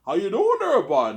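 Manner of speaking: high, loud mock Canadian; exaggerated Canadian accent. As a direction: ham up a hardcore Canadian greeting. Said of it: "the difference in their speech".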